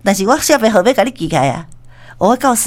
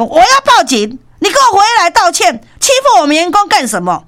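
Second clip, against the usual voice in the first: raised, louder indignant yelling; angry threat; 8 semitones higher, 3 dB louder than usual. Retold or imitated. Store manager to the husband, shouting an angry threat. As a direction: shout him down in outrage. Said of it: "这个店长就讲".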